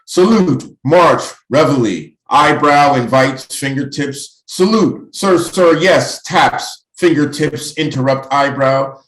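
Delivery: high, loud, punchy recitation; poetry recitation. Acted. Poet evoking cadets in training, loud declaimed poem. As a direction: fire off the words like drill commands.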